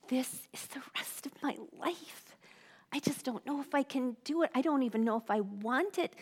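re-live the despair, softly, starting near a whisper; despairing night thought. Retold or imitated. Recalling her thoughts on nights of grief, quiet despair. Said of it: "nights that I would think".

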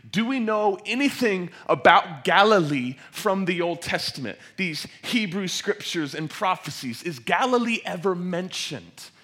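loud, high, building sermon delivery; full-force preaching. Real, not acted. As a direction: preach it with mounting urgency to the whole room.

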